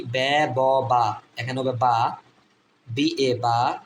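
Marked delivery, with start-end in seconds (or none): 0.0-1.2 বে, ব, বা as three separate drawn-out syllables; pronunciation options. Shown as a demonstration. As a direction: sound out বে, ব, বা one by one. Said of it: "বিএ উচ্চারণে কি?".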